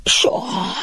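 word stretched through a high yawn; sleepy, yawning resignation; resigned yawn. A narrator's reading. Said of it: "ásított Maci lemondóan".

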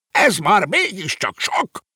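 high-pitched, drawn-out outraged screech; shrill, scandalised protest; indignant screech. A narrator's reading. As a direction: screech it slowly in sheer outrage. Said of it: "rikálcsolta felháborodottan".

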